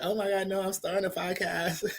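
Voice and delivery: put-on ditzy girl voice, breezy and high; airhead ratchet voice. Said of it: "the airhead ratchet voice".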